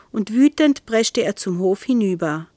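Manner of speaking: plain reading voice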